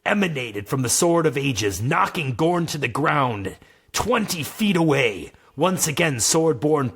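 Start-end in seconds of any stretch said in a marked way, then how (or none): none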